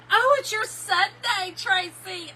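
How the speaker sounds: high, slow, laughing-through greeting; high excited greeting. Real, not acted. Greeting a viewer mid-laugh, high and excited. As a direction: call out to Tracy, pitch up high.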